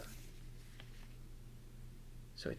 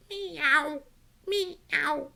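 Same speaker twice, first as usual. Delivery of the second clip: very high meowing; robot cat meow. Acted. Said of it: "Robot cat".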